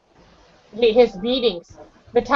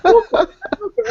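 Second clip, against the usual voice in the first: mock angry babushka; 12 semitones higher than usual. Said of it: "My babushka comes to me like this".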